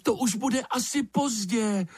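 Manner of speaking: high-pitched cry at ordinary volume. A narrator's reading. Lizard protests that waiting is too late, crying out high. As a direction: cry it out high, without a full shout. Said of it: "vykřikla ještěrka".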